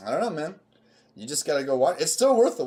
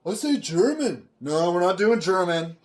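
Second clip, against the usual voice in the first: high mock voice of a dithering studio boss; mocking the filmmakers; 7 semitones higher, 3 dB louder than usual. Mocking Terminator's makers to a friend, high hammed-up studio voice. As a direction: play a silly producer choosing the accent, slowing at the end. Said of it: "they go like".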